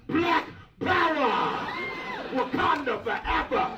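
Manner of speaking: slow, high, drawn-out rally cry; inner cheering shout. Retold or imitated. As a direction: roar it like a fan in the stands. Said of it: "That's what I say on the inside".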